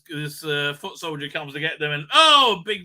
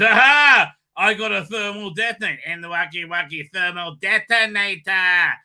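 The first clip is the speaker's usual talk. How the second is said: triumphant entrance; 3 semitones higher, 6 dB louder than usual. Retold or imitated. Jack bursts in triumphant.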